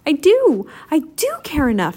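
high, loud insistent shout. A narrator's reading. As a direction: protest it with all his heart. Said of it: "shouted Paul".

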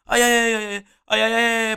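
high drawn-out mock whimpers; ais de dor exagerados. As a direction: whimper like a swab hurts. Mimics the pain of the test. (ais agudos a fingir dor)

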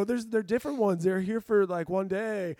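high, loud nervous stammering lie; flustered lie. Retold or imitated. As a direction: scramble to invent an excuse. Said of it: "And I go".

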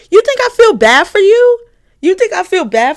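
loud indignant rant; shouting. Real, not acted. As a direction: shout it in disbelief. Angry rant, then "don't get loud.

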